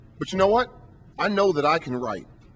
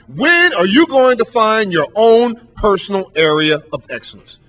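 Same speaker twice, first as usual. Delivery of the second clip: louder, slowed, driving each word home; emphatic question; 7 dB louder than usual. Real, not acted. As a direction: lean into the challenge. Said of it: "preacher hammering a question".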